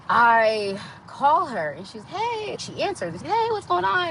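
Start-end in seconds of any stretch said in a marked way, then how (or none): 2.0-2.6 very high, bright "Hey" imitating an eager cousin; imitating the cousin's delighted hello; excited cousin greeting. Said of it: "she's, like, excited to talk to me". 3.2-4.1 imitating a cousin, much higher and a bit louder; high, cheery phone greeting from the cousin; excited cousin. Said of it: "she's, like, excited to talk to me".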